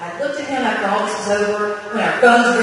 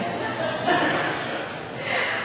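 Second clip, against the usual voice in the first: a breathy chuckle at her own slip; quiet unvoiced laugh; 8 dB quieter than usual. Real, not acted. Speaker laughing at her own slip about her husband.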